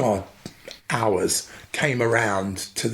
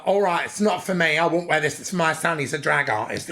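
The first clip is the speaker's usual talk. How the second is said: higher, proud mum's voice; imitating his mum; 6 semitones higher than usual. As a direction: play the mother boasting about her son. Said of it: "she was a real character".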